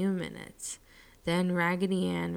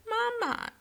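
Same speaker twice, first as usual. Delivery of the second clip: much higher squeaky Mama; squeaky doll voice. A narrator's reading. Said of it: "in a squeaky voice".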